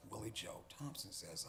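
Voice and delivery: usual speaking voice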